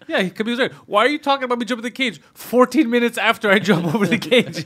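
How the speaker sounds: high, mock-exasperated complaint; Khabib. Fighter complaining minutes after jumping the cage, comically indignant. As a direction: act out a baffled fighter's grievance. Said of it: "he's like".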